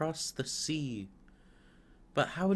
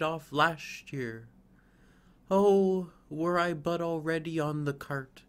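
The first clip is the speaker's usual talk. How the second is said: higher, louder, ardent yearning; longing fir; 5 semitones higher, 4 dB louder than usual. Acted. Fir yearning for Christmas and the warm room. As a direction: pour out the longing. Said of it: "cried the tree, rejoicing".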